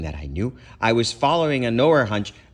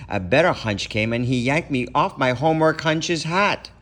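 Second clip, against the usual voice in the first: ordinary delivery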